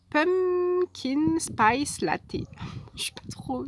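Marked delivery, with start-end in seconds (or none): 0.1-2.4 put-on slow, careful, higher try at an English accent; half-hearted English accent; English accent attempt. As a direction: stretch out the English words in a shaky English accent. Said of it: "je suis pas trop accent anglais".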